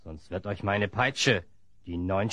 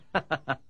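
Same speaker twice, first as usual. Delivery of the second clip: gloating laugh; 6 semitones higher than usual. Acted. Villain gloats over captives.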